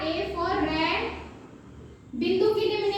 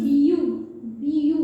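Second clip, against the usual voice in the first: BU called out loud, a little lower; loud teaching demo; 3 semitones lower, 5 dB louder than usual. Shown as a demonstration. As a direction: hammer BU home for the students. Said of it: "क्या बोल रही हूँ".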